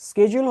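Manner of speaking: American Schedule, said "SKE-jul"; American pronunciation. Shown as a demonstration. Teacher naming the American form, Schedule as "SKE-jul". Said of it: "হচ্ছে American accent".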